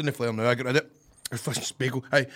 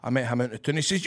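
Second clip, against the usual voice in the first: normal talking voice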